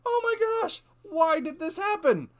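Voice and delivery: high, loud wail of hammed-up dismay; mock baffled customer. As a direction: cry out like a melodramatic, clueless driver. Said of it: "And he wonders".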